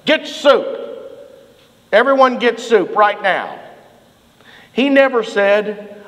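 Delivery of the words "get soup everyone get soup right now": much higher, louder barking orders; gruff sergeant. Retold or imitated. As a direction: bark orders at freezing troops. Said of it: "he would say something like".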